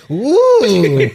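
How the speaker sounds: long, loud, high "ooh"; crowd-style ooh of mock amazement; drawn-out wowed ooh; awed ooh. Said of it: "everyone's like".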